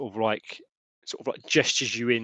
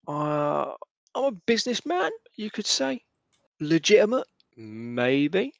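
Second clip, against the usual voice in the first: higher, sly smuggler voice, coy and knowing; a shady businessman hinting at more; Hamish; 4 semitones higher than usual. Acted. Hamish confiding to Wilfred, higher and sly.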